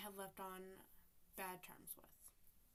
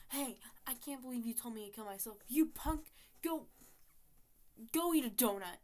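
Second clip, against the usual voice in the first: loud, punchy telling-off, getting louder; play-acted outburst, much louder by the end; mock angry comeback; 2 semitones higher, 11 dB louder than usual. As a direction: hurl a hammed-up insult, building to a shout. Imagined angry comeback she didn't say.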